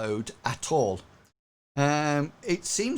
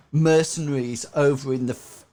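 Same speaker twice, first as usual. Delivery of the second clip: usual speaking voice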